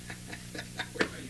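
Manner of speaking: breathless unvoiced laugh; silent wheezing laugh. Real, not acted. Host cracking up at Howard's book, silent wheezing laugh.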